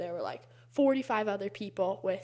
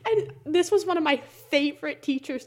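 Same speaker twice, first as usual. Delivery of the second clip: ordinary talk, nothing marked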